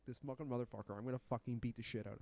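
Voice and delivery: soft, fast, seething mutter under the breath; a mild mock grumble of rage; quietly fuming to himself; angry muttering. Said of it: "'cause I was like".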